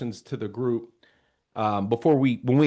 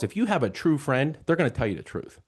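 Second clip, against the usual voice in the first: ordinary talk, nothing marked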